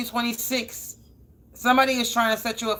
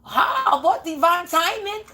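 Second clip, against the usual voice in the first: higher mock exasperation at being told to wait; lightly played fed-up self, pitch raised; half-laughing at God, how much more; fed-up disbelief; 6 semitones higher than usual. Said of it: "I be like".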